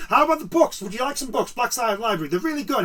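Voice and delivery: high, eager salesman pestering; hammed-up clerk pushing paint; pushy shop assistant. Mocking pushy shop staff.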